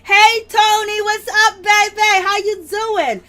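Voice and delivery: loud, high, sing-song hello; high greeting voice. Pretend. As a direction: greet him big and high. Greeting Tony in a put-on voice.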